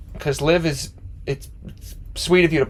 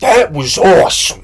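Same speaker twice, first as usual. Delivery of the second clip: slow, loud, higher gushing fan voice; drunk fan impression; 5 semitones higher, 7 dB louder, slower than usual. Fan telling the band about the show, slow loud gush. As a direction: ham up the excited concert-goer, drawn out. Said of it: "I'm doing a perfect impression right now".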